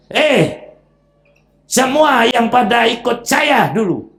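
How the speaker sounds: rally the crowd like a fiery orator; Iblis addressing followers. Acted. Iblis rallying his followers in hell, a fired-up speech. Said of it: "pidato dia. Semangat lagi pidatonya".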